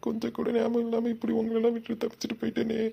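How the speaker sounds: high, tearful lament of regret; guilty wailing. Acted. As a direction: blame yourself, weeping over her. Husband wails guilt over wife.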